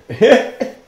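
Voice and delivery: high, loud burst of laughter; a short, loud laugh. Real, not acted. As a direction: laugh out at your own story. Laughs at his own story.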